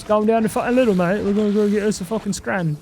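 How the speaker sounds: mock British lad. Streamer goofing to chat in a mock British lad voice. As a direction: play a cheeky British bloke off to the shops.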